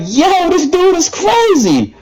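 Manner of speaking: high, slow stunned exclamation; drawn-out amazed yo. Retold or imitated. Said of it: "I'm like".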